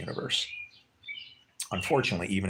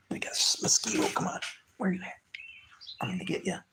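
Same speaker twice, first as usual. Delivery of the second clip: half whispered, softer, stalking a mosquito; coaxing a mosquito in a near whisper; hushed mosquito hunt; 8 semitones higher, 4 dB quieter than usual. Real, not acted. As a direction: creep up on the bug under your breath. Hunting a mosquito.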